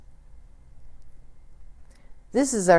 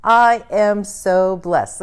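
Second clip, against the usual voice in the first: slow, loud, higher declaration of blessing; affirmation; 4 semitones higher, 6 dB louder, much slower than usual. Acted. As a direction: proclaim each word with joy. Affirmation then repeat.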